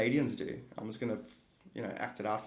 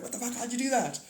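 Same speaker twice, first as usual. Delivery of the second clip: amazed audience; 10 semitones higher than usual. Pretend. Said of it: "And it's like".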